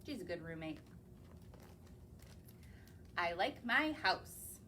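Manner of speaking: usual speaking voice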